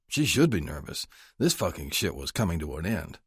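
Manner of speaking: plain reading voice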